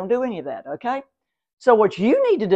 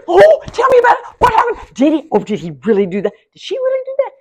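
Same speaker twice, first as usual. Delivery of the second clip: much higher, louder, breathless mock gossip; eager gossip; 10 semitones higher, 10 dB louder than usual. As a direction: play the nosy friend hungry for drama. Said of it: "Because you go".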